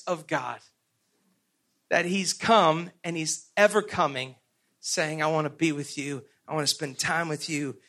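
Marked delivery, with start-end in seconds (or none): 5.2-7.8 low, soft, gentle; tender voice of God. Retold or imitated. Preacher voices God longing to be with us, soft and tender. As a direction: speak it like a quiet promise.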